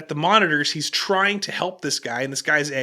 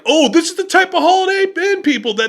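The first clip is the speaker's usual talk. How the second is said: high, loud mocking outburst; jeering outrage; 9 semitones higher, 5 dB louder than usual. Customer filming the sobbing employee, loud jeering. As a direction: crow it loudly, imitating a scornful heckler.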